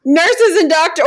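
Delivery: laughing through it; high, amused, half-laughing; self-quote. Retold or imitated. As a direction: giggle while imagining wanting a good cry. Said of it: "I just, like".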